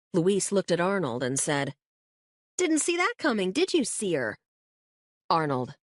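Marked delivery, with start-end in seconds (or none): 2.6-4.4 high, teasing jab. Acted. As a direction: needle him playfully. Luis teasing Arnold.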